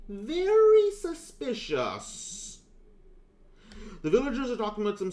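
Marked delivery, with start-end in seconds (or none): 0.1-2.6 very high, very slow drawn-out voice; mock high suspicious voice; suspicious squeak. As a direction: stretch it out in a high silly pitch. Voicing NPC reaction.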